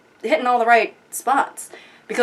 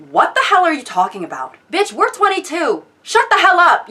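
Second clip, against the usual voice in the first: exasperated rant, pitch jumping high; indignant, high-pitched telling-off; worked up; 7 semitones higher than usual. Acted. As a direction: snap back in comic outrage. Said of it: "get a little worked up and perhaps a bit unpleasant".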